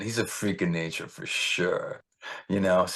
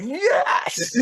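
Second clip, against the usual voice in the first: very high, elated cheer; high-pitched victory cry; triumphant yes; 4 dB louder than usual. Retold or imitated. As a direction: celebrate a big win in a squeal. His triumphant reaction to text.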